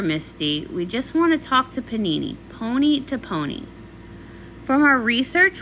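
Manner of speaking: ordinary talk, nothing marked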